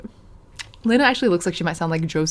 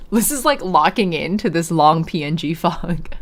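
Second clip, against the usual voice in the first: normal talking voice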